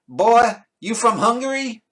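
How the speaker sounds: loud, slow, high Texan drawl; hammed-up Texan, loud and incredulous; Texan accent. Texans asking where he's from, mock Texan drawl. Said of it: "I've had Texans are like, they go".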